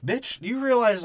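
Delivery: high-pitched incredulous comeback; mock indignation. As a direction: swoop up high in pretend disbelief. Acted indignant comeback to the mom.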